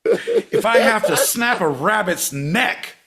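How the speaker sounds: loud, high, drawn-out yelling; over-project every word, hammed-up; mock enraged shout. Podcaster overdoing a point to his co-host, mock shout.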